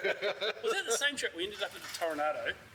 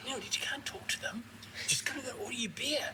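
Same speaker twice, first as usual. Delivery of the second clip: higher, hushed whisper; urgent whisper; 8 semitones higher than usual. Retold or imitated. Warning a friend at a rude bar, in a quick whisper.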